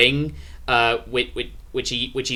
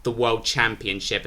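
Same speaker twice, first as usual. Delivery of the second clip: usual speaking voice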